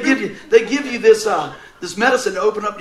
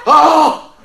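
sudden loud high "Oh!"; shocked exclamation; 7 semitones higher, 11 dB louder than usual. Real, not acted. Reacting to news of [name]'s death, a loud surprised "Oh!". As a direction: blurt it out, louder and higher.

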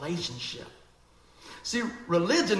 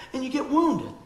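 ordinary delivery

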